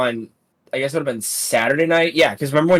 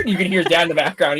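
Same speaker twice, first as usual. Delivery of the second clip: normal talking voice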